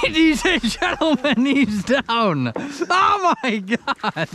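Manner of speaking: high, breaking with laughter; laughing announcement. Real, not acted. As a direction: announce it through helpless laughter. Mock announcing friend's fall.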